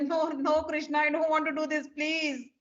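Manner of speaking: mildly hammed-up reluctant pleading; pleading refusal. Acted servant begging Krishna off.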